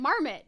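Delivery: "marmot" with a reduced last vowel, "MAR-mit"; own pronunciation. Shown as a demonstration. Answering with her own way, "marmot" ending in "mit". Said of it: "I would say marmot".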